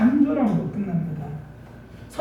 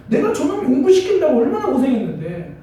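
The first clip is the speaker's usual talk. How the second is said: imitated parent's indignant grumbling, higher and louder; loud, high-pitched mock reproach; resentful complaint; 6 semitones higher, 5 dB louder than usual. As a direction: act out a mother's grievance. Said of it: "원망이 많았거든요".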